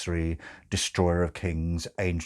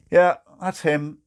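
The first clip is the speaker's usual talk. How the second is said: Crowley; 9 semitones higher, 8 dB louder than usual. Acted. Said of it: "said Crowley".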